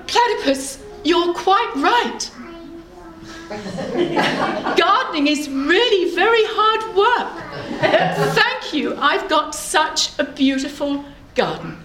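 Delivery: high, delighted singsong; joyful storybook character, pitched high; so happy. Acted. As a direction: gush with gratitude. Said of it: "She is so happy".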